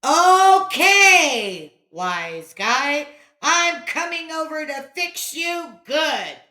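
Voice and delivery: loud, high angry threat; bellowed. A narrator's reading. Boy threatening the wise guy, angry bellow. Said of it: "Johnny bellowed".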